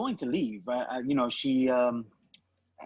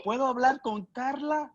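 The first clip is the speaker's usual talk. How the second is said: put-on higher, overly polite choir boy voice; 9 semitones higher than usual. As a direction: sound as innocent as possible. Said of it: "And I did the choir voice".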